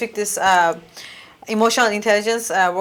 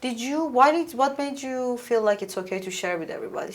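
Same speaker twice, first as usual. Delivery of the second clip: polite quiet question; 8 dB quieter, much faster than usual. Retold or imitated. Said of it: "I asked her very politely".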